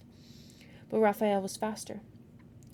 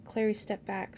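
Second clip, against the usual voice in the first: ordinary delivery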